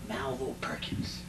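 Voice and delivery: hushed whisper of the name. Real, not acted. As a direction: breathe the name out, almost silent. Naming the outlaw he killed.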